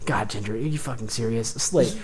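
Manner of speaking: low, soft exasperated thought; hushed inner disbelief; internal monologue. Acted. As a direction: grumble it privately, under your voice. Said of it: "internally you hear him say".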